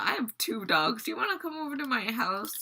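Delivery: acted-out eager fan, high and loud, speeding up; over-eager gushing. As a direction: blurt it high and fast like an overexcited fan. Retold over-eager invitation to idol.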